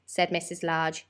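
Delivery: ordinary delivery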